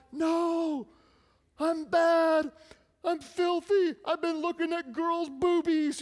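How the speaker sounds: put-on high, loud, anguished kid confessing; retelling himself as a boy confessing to mom; guilty little boy. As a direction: blurt it out like a guilt-ridden young boy. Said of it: "I'm like".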